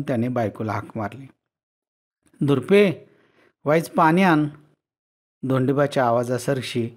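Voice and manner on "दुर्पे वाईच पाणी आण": called out loud and high toward the house; calling out. Acted. Tired farmer calling his wife indoors for water, loud call. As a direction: shout across the yard to the wife, tired. Husband calls wife from yard.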